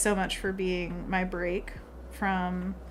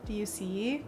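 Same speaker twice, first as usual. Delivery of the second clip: soft hushed "Do you see?"; quiet question; 6 dB quieter than usual. Real, not acted. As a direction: ask softly. First take, quiet.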